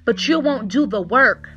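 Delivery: fired-up sermon delivery; exhorting preacher. Real, not acted. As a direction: challenge the congregation with pointed, ringing questions. Preaches, challenging the congregation.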